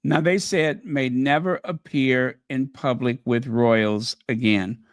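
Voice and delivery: ordinary delivery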